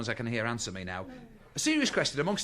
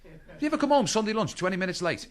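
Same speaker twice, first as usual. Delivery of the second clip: normal talking voice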